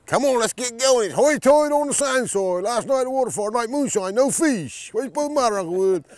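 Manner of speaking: put-on high, loud calling-out voice of an old fisherman uncle; teasing uncle. Uncle hollering at his young nephew while fishing, high and loud. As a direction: call out high and loud like a needling uncle on the boat. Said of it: "he said".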